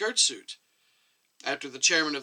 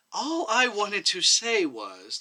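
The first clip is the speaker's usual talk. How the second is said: timid, slow start, a bit higher; 7 semitones higher, 5 dB louder, much slower than usual. A narrator's reading. Chubby doctor timidly speaks up to the commission, slow and timid. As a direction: venture it timidly and slowly. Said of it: "the chubby doctor spoke up timidly".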